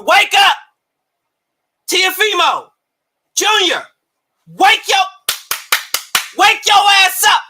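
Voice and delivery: wake-up yell. Real, not acted. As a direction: shout at him to wake up. Yelling at Teofimo to wake up.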